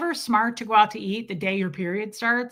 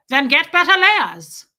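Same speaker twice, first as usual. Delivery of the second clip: put-on loud, barked German-accented order; higher, booming German accent; terrifying German accent; 5 semitones higher, 10 dB louder than usual. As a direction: imitate a scary German woman, loud and forceful. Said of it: "she was terrifying".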